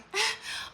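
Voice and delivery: soft high giggle; embarrassed laugh. Real, not acted. After yelling at the dog, a soft giggle.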